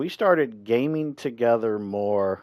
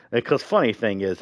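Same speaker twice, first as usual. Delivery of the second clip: ordinary talk, nothing marked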